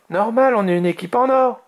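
high-pitched playful boast; cheeky young dragon quip; joking. A narrator's reading. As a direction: joke it proudly, voice up. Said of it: "plaisanta Azuro".

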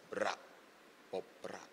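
short crisp drum syllables; snare drum imitation. Shown as a demonstration. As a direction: mouth a tight military snare. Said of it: "it needs to sound like a military drum".

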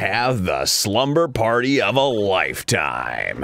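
hammed-up deep trailer announcer; movie trailer voice. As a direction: sell the film like a blockbuster announcer. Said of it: "What should I do a movie about?".

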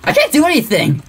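high-pitched exasperated cry; frustrated outburst. Real, not acted. Stuck player vents frustration.

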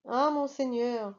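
plain reading voice

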